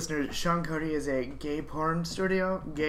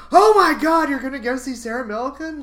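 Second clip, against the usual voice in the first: excited dad; 9 semitones higher, 10 dB louder than usual. Retold or imitated. Dad excited about comedian.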